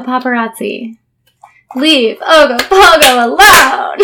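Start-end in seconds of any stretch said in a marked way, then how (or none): 1.8-4.0 loud, slow mock plea, high-pitched; hammed-up tearful fan begging, drawn out. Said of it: "making the crying [name] video".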